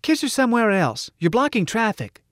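put-on scornful little girl. A narrator's reading. Little girl to a couple blocking the door, scornful. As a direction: pipe up with a child's disdain. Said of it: "looked up scornfully and said".

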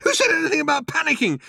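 high, fast, indignant retort; snapped. A narrator's reading. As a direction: snap back defensively. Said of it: "snapped Arthur".

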